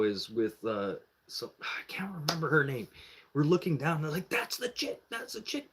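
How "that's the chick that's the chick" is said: high, soft imitated squeal; tiny high-pitched hushed voice; mock hushed excitement. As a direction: imitate friends spying excitedly, keeping it down. Friends spying from the balcony.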